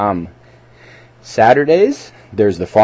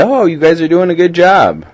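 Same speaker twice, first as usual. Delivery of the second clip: high, loud mock cheer; pitched-up, hammed-up encouragement; encouraging cheerleader; 8 semitones higher, 5 dB louder than usual. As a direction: call out praise like a volunteer cheerleader. Voicing cheerleaders praising builders.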